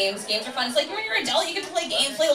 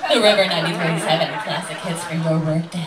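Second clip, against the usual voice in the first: low, quick radio station ID; radio announcer; 8 semitones lower than usual. Retold or imitated. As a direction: do a mock classic-hits DJ.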